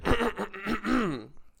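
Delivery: demonstrative throat clear. Shown as a demonstration. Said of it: "You have to clear your throat".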